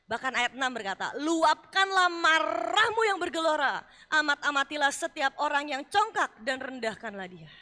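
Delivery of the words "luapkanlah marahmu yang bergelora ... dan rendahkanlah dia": loud scripture reading. Acted. Reading God's words loudly.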